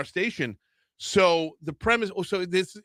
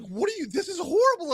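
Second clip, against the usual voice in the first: daughter; 10 semitones higher, faster than usual. Retold or imitated. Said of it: "my daughter's like".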